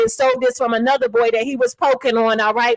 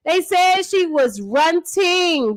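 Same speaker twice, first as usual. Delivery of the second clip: usual speaking voice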